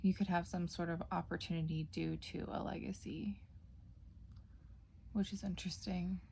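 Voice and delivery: soft whispered aside to viewers. Acted. Tarot reader whispering her reading to viewers. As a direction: drop into a hushed ASMR whisper.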